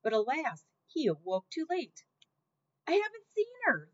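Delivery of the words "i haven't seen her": Louise yells she hasn't seen her; shouting. A narrator's reading. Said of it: "Louise was shouting".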